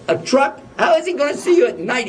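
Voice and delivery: can't believe she's worried; incredulous. Retold or imitated. Husband scoffing at his wife's fear of trucks, incredulous and quick.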